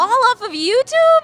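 high, loud astonished question; can't believe it, pitch way up; amazed. Real, not acted. Host amazed at book deal.

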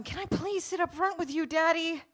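high, wheedling daughter's plea; pleading. A narrator's reading. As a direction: beg Daddy, ending loud and high. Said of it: "Rebecca pleaded one more time".

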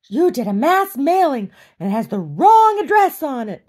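shrieking high and loud down the phone; retold scream. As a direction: yell it like the furious caller.

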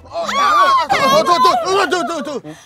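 very high pleading that fades down; frantic pleading. Acted. Father begs the suitor to stop beating his daughter. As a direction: beg him to stop, high then sinking.

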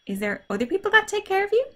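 ordinary delivery

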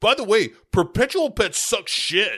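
mock bratty parting shot, pitched high; bitching kid. Child topping off her tantrum at guardian, mock bitching. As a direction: imitate a kid's petulant jab, well above normal pitch. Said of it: "Katie is bitching about having Megan".

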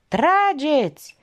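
high, long-drawn command; yelled order. A narrator's reading. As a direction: stretch the order out as a cry. Wounded man again orders to shoot. (ordin strigat prelung)

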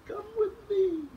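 very high, small calling voice; a tiny creature calling up from the floor; high tiny insect calling Rata to follow. Acted.

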